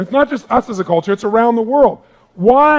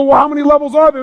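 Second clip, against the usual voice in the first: eager question; 7 semitones higher, 5 dB louder, faster than usual. Retold or imitated. Imagined audience member asking eagerly.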